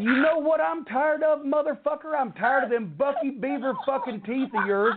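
reliving the moment he snapped at him; retold angry outburst. Retold blowup at production meeting.

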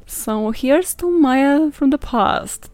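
hammed-up announcer toast; mock toast. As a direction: raise a glass to the past self. Toasting her past self.

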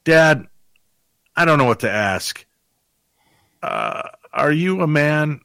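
put-on drawn-out, hesitant kid's whine; daughter losing nerve. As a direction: plead to dad, unsure what to ask. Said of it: "It's real brave for a second".

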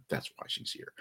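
snarky whispered aside; conspiratorial unvoiced aside. Real, not acted. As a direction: drop to a whisper for the dig. Snarky aside about Nelson.

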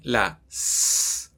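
long voiceless hiss, no voice; hissed S sound. Shown as a demonstration. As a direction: push air through the teeth: sss. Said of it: "Nuestros dos sonidos de hoy".

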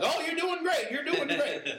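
high sing-song encouraging nurse voice; cheery nurse. Retold or imitated. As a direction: chirp it like an upbeat nurse cheering her on. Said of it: "they're still like".

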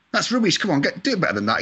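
high, mock-bossy jibe at [name]; teasing bosses. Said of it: "taking the mickey out of me".

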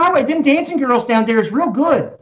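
high, hammed-up Baltimore accent. Shown as a demonstration. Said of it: "that's the Baltimore accent".

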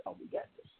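whispered, mocking their confidence; smug whisper. Mocking the prosecutors strutting into court, in a smug whisper. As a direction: hush it like a cocky insider. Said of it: "they all walked in there".

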